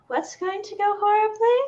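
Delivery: high breathy nervous voice. Acted. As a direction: breathe it out, timid and airy. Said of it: "you hear a breathy voice from the doorway".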